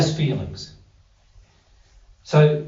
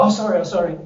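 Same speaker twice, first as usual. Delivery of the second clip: quick polite apology, pitched a bit higher; 4 semitones higher, 3 dB louder, much faster than usual. Retold or imitated. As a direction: act out a reflexive polite sorry. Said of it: "the polite thing to say is".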